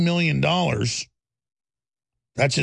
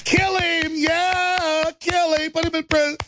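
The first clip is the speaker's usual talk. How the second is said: mock bloodthirsty shout; 13 semitones higher, slower than usual. Imagined crowd demanding his arrest, mock shout. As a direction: shout it like a jeering mob. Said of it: "they'd be like".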